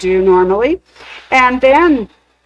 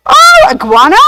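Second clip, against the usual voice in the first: high delighted squeal; surprised squeal; 13 semitones higher, 6 dB louder than usual. Real, not acted.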